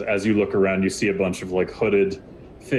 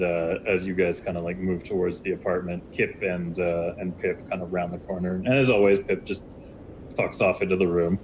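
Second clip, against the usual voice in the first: normal talking voice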